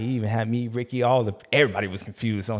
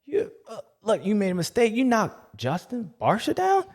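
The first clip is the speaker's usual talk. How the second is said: high mock confusion; 11 semitones higher than usual. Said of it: "I'm like".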